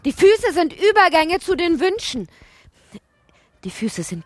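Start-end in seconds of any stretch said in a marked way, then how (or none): 0.1-2.3 much higher and louder, declaimed; raised incantation. Acted. Narrator proclaims the feet's meaning, high and loud. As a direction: proclaim it like a chant. Poetic line voiced apart.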